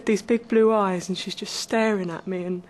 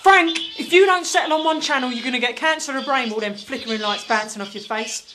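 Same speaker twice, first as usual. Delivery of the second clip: scolding nag; 3 semitones higher, 8 dB louder than usual. Acted. Nagging Frank about TV.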